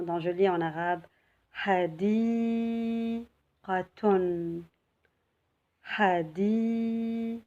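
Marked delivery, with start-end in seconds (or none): 1.6-7.4 "Hadiqatun" slowly, long held DI; slow model reading. Shown as a demonstration. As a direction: stretch the middle of "Hadiqatun" before finishing it. Teacher models reading an Arabic word.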